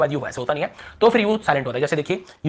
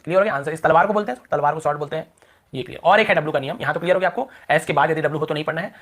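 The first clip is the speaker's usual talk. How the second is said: ordinary talk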